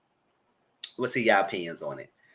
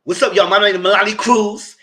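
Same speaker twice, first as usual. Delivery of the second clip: loud, higher hyped-up intro; imitating hype intro; 9 semitones higher, 10 dB louder than usual. Mocking Melody's loud first greeting to viewers. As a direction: bark the greeting at them. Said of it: "barking at us like you saying your name".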